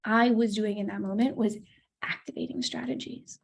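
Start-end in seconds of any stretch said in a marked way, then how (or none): 2.0-3.4 hushed breathy whisper on the term. Shown as a demonstration. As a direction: drop to a whisper for emphasis. Repeats term, whispered and unvoiced.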